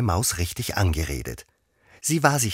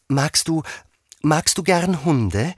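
high, loud, drawn-out question; eager high-pitched question; Alice eagerly asking; 10 semitones higher, 4 dB louder, slower than usual. Acted. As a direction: search for a new topic, then ask brightly. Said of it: "fuhr Alice eifrig fort".

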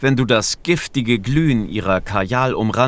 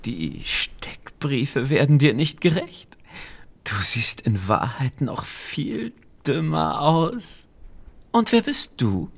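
mocking witch; 3 semitones higher, 4 dB quieter than usual. A narrator's reading. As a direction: purr the insult like a sly witch. Witch mocks the thief.

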